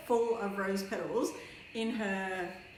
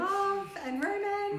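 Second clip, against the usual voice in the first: dreamy announcer; 11 semitones higher than usual. Pretend. Mock dreamy show title.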